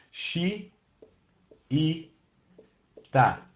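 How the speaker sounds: "Xi, i, ta" split with a pause between each syllable. Shown as a demonstration. Teacher splitting a word into syllables for a student, spaced syllables. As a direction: split "xiita" into "Xi", "i", "ta" with clear gaps. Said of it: "os xiitas".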